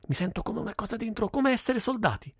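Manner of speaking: put-on high-pitched childlike enthusiasm; thrilled kid, pitched well up; excited child. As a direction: voice climbs high with boyish excitement. Boy enthusing about mock battles.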